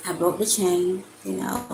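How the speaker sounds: ordinary delivery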